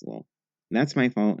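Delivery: ordinary delivery, nothing marked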